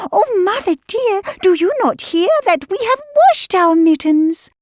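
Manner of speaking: high, quick little kitten voice; quick high kitten pleading; eager kittens. A narrator's reading. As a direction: hurry the news out to mother, high-pitched. Kittens plead with mother cat.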